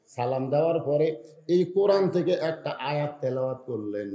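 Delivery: ordinary talk, nothing marked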